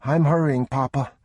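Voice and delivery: put-on hurried kid calling to his dad; young boy. A narrator's reading. Boy hurrying after his father. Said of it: "get a move on".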